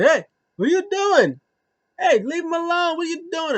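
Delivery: high, loud hammed-up shouting; squeaky panicked outcry; mock yell. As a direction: shout in alarm at the attacker. Voicing Dominic yelling.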